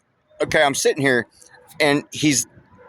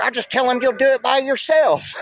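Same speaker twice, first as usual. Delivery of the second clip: much higher mock version of the coworker's brush-off; mocking how the coworker dismissed him; imitating coworker. Coworker's parting line quoted.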